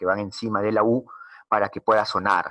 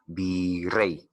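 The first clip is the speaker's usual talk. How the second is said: "virrey" sounded out in drawn syllables; stressed word demo; 2 semitones lower, 3 dB quieter than usual. Shown as a demonstration. Teacher gives example of palabra aguda, "virrey" sounded out deliberately. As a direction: draw out both syllables of "virrey". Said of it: "Si tenemos una palabra aguda".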